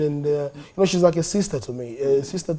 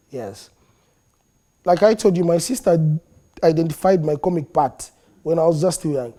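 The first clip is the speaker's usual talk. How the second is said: normal talking voice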